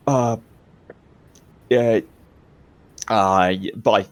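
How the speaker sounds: halting, awkward farewell with long pauses; hesitant, socially awkward sign-off; awkward goodbye. Acted. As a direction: fumble your way out of a goodbye. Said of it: "I don't know, he's awkward".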